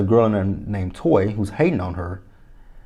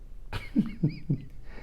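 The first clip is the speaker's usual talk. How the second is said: a quick airy laugh; soft airless chuckle; breathy laugh; 7 semitones higher, 12 dB quieter than usual. Real, not acted. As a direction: laugh under your breath. Laughs at the diaper story.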